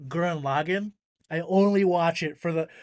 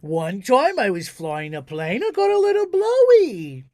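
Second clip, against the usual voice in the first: put-on voice swooping up into high squeals; silly filtered character voice; 6 semitones higher, 4 dB louder than usual. Shown as a demonstration. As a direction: stretch it out, leaping high and loud. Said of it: "I can do a filter just like this".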